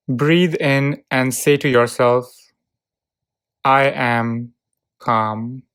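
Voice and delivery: ordinary talk, nothing marked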